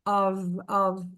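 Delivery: "Of" ending in a V sound; teacher models the weak form. Shown as a demonstration. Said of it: "Ele é um V na fala".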